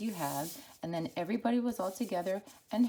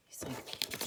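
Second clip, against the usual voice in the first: ordinary delivery, nothing marked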